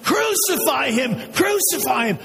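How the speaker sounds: high, repeated crowd shout; insistent mob chant; screaming mob. Acted. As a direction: cry out as an angry mob chanting for blood. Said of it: "they started screaming".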